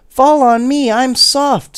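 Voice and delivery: high, loud eager voice; rag doll Judy. A narrator's reading. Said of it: "said Judy".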